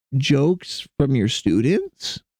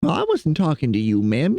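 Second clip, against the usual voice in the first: ordinary delivery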